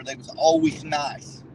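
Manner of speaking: normal talking voice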